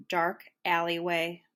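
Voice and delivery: ordinary delivery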